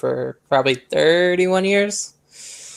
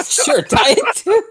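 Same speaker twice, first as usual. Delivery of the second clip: high, loud laughing reply; 11 semitones higher, 4 dB louder, slower than usual. Real, not acted. Host answering co-host's diet soda question, laughing. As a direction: let the giggle push the words up.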